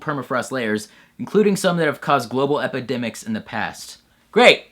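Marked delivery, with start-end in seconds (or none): none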